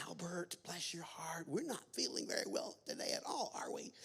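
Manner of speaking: hushed, sing-song cooing; cooing old lady. Acted. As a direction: play an old lady fussing over a little child. Old lady coos at little boy.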